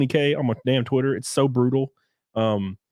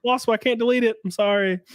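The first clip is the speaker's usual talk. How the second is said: fake excuse; 11 semitones higher than usual. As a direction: plead innocence, milking it. Fake excuse for not deleting the leak.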